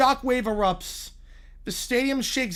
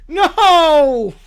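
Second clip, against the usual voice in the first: loud, high No; outraged shout; 4 semitones higher, 10 dB louder than usual. Acted. Character refusing, shouted loud. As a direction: blast the denial.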